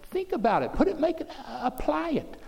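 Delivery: high, urgent preacher's call; preaching at full force. Acted. Preacher urging the congregation to pray from the heart, fervent. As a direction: press the question on them, pitch climbing.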